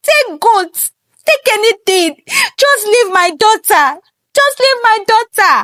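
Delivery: high, fast wailing scream; screaming mother. Acted. Queen screams at her husband for selling their daughter, high and frantic. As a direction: scream and plead in panic.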